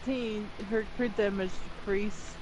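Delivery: usual speaking voice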